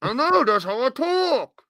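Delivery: words slurred together, hard to understand; mumbled voice. Pretend. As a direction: mumble it like a mouthful. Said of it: "What, what?".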